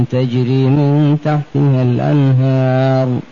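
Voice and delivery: normal talking voice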